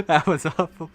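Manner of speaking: playful imitation of the friend's complaint; mock echo. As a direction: throw their line back in a teasing put-on voice. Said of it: "That was intentionally awful".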